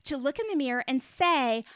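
ordinary delivery

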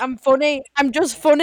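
mock-defensive shout; loud protest. Retelling their answer to being called a joker, loud mock protest. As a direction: insist on it loudly, playing up the protest.

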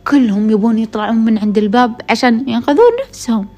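usual speaking voice

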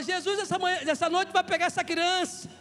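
loud, high, driving proclamation; full-force preaching. Real, not acted. Preacher declaring Jesus will heal the child, shouted preaching. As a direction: build it like a pulpit declaration.

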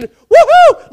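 loud, very high cheering whoop; ball game whoop. Shown as a demonstration. Preacher showing his ball game cheer, huge whoop. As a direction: let out a fan's yell. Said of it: "shouting and clapping".

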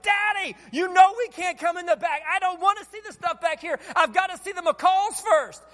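put-on high, shouting kid's protest; upset little boy. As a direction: protest like a frustrated child. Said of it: "And Aiden's like".

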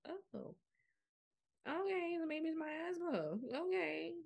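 high-pitched acted-out "oh, okay"; dawning realisation. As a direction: replay the moment it clicks, voice lifted high. Acts out her dawning realisation.

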